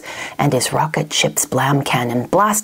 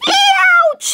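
high, loud cartoon ouch; pained yelp; 5 dB louder than usual. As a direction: yelp as if poked from behind. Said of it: "he yelped".

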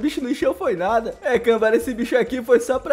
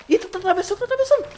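higher, rising excitement; excited; 9 semitones higher, much faster than usual. Real, not acted. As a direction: voice jumps up as it crosses. Dinossauro atravessa. (empolgado, voz subindo)

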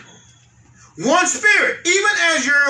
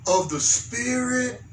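softer and very slow; hushed, slow verse; slow scripture reading; 7 semitones lower, 11 dB quieter, much slower than usual. Shown as a demonstration. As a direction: let each word hang.

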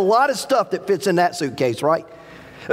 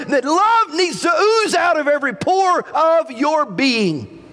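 emphatic preaching, rising higher as it builds; impassioned preaching; 4 semitones higher than usual. Real, not acted. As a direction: drive it home to the congregation, drawing out key words.

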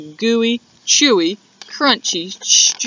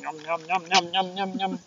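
soft tasting yums; 4 semitones lower, 12 dB quieter, faster than usual. Pretend. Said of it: "Let me have a taste".

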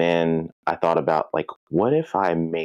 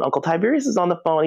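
quick repeated call; 12 semitones higher, much faster than usual. Retold or imitated. Sister repeats the call, faster.

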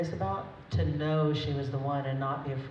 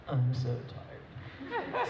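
put-on sleepy boy; 4 semitones lower, 3 dB quieter, much slower than usual. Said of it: "he's like".

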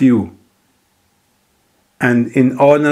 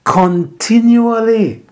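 preaching at full force; 6 semitones higher, 3 dB louder than usual. Acted. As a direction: lift the verse up to the rafters. Preaching builds.

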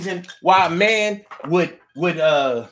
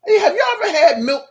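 ordinary delivery

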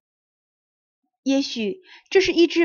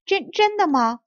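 high, stammered excited question; overjoyed cry; 8 semitones higher, 4 dB louder than usual. A narrator's reading. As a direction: cry out in delight, tripping on the first word. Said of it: "螃蟹们一起嚷了起来". (高兴得嚷起来)

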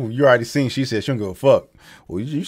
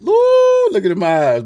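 surprised; 10 semitones higher, 6 dB louder than usual. Real, not acted. Reacting to a contestant's face.

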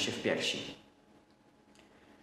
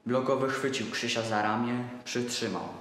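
usual speaking voice

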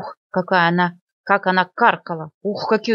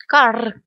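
short loud caw; crow caw; 4 semitones higher, 4 dB louder than usual. Acted. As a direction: caw sharply and fly off. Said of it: "крикнула она". (резкое карканье вороны)

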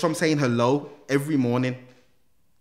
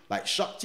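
shocked gasp. Retold or imitated. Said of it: "So everyone's like".

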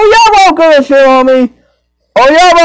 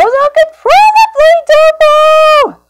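much higher, squeaky cartoon puppet. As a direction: squeak it out like a tiny wooden puppet. Pretending to be Pinocchio.

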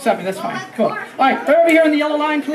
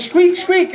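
higher squeaky second squeak; screwdriver squeak; 6 semitones higher than usual. Shown as a demonstration. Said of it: "it goes".

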